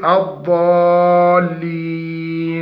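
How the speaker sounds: الضالين drawn very slowly with a long, humming nasal nun; over-nasalized nun. Shown as a demonstration. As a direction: make the nun of الضالين ring too long. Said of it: "ما نقول... هذا خطأ". (تطنين النون خطأ)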